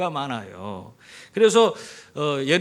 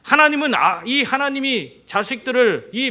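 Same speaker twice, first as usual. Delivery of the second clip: high, loud 하나님은; preacher rising to a peak; fervent; 6 semitones higher, 6 dB louder than usual. Real, not acted. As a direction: cry out 하나님은 from the pulpit. Preaching climax.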